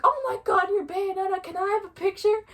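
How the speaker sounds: high, gushing imitation of a fan; mimicked high-pitched fan squeal; excited fan. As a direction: blurt it out, thrilled to recognize someone. Excited fan recognizing cosplayer acted out.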